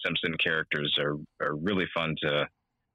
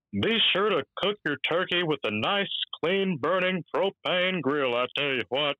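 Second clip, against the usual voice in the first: Hank voice; 10 semitones higher than usual. Acted. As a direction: do Hank selling propane, pitched well up and stretched. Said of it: "I did a Hank voice for him".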